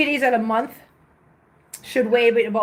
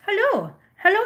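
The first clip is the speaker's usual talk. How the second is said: cooing hello; 9 semitones higher than usual. Real, not acted. Greeting a cat.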